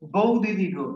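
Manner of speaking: stressing বউ with a falling tune; quoted lyric. Shown as a demonstration. Teacher quoting the song to the class, stressing বউ. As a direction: sing out "বউ দিদি গো" leaning on বউ. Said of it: "দেখো কি বলেছে ও?".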